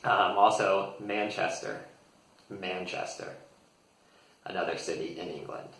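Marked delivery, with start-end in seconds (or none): 1.0-3.3 "Manchester" said MAN-chess-ter with American r; American pronunciation. Shown as a demonstration. Teacher naming another English city, American "Manchester". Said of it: "share with you the American pronunciation".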